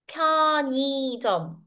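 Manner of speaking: 편의점 run together as pyeo-nii-jeom; linked pronunciation. Shown as a demonstration. Teacher shows how the word is pronounced, linked n. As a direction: carry the n of 편 into a long ni. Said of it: "This is how we pronounce".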